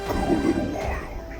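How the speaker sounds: ordinary delivery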